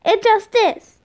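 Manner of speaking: mild, whiny mock shout; shouted protest. A narrator's reading. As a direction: insist like a cross kid. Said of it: "Tom shouted".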